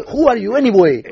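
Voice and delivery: imitating the neighbour demanding who he is; mock indignant challenge. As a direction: fling the question at him with mock outrage. Retold rich neighbour's challenge.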